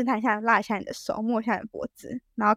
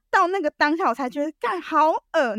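ordinary talk

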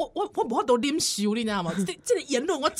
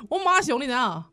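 ordinary talk

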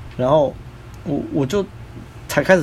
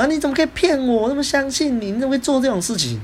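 agitated; 12 semitones higher than usual. Retold or imitated. Friend A still upset, repeating the accusation, high agitated voice. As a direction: mimic the friend still worked up, voice high but level. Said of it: "他就还是很激动".

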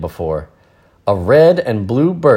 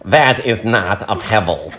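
a smug know-it-all line cracking into laughter; smug nerdy girl correcting her friends; know-it-all, laughing. Acted. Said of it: "that voice".

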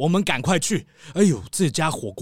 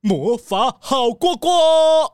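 drawn-out, higher proud announcement; 7 semitones higher, 6 dB louder, much slower than usual. Acted. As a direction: announce the name with relish. Mayor proudly announces shop name. (骄傲地拉长宣布)

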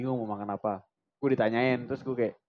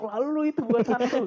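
much higher mock wife's voice; wife's quip; 9 semitones higher than usual. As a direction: chime in like a teasing wife. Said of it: "bininya yang nyeletuk". (meniru suara istri yang nyeletuk, nada jauh lebih tinggi)

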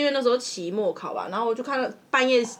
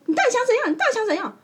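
mock angry; 12 semitones higher, faster than usual. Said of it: "然后那女的就说".

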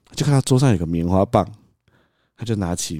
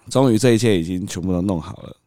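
usual speaking voice

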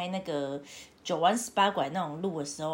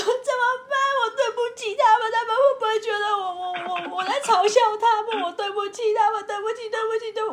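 much higher and louder, frantic tearful apologies; mock panicked crying; 14 semitones higher, 6 dB louder, faster than usual. Retelling crying apologies in the car, hammed-up tearful panic. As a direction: imitate her sobbing sorry in a rush. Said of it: "我就开始哭".

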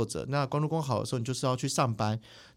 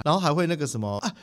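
usual speaking voice

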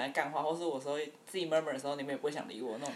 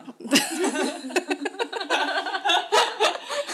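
high loud laugh; 12 semitones higher, 9 dB louder than usual. Real, not acted. Laughs at own awkwardness.

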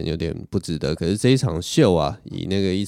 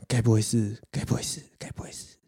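three anxious repeats, each one quieter until it is only breath; fading to a whisper; 3 semitones higher, 6 dB quieter than usual. Retold or imitated. Two friends too scared to say it, fading into a whisper. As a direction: let the guess die away into a whisper. Repeating the dreaded unsaid guess.

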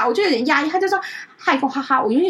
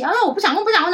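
impatient bird; 9 semitones higher, faster than usual. Retold or imitated. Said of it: "他就生气".

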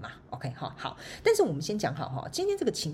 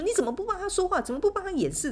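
mock angry boss; 13 semitones higher than usual. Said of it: "这样大吼大叫".

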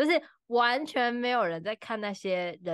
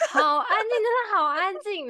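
high and loud, laughing through it; 11 semitones higher, 8 dB louder than usual. Real, not acted. Host laughing about the silent crowd. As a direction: giggle about the dead-silent stands.

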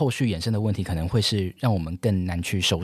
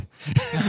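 high laugh; 11 semitones higher than usual. Real, not acted. Laughing at the teacher story.

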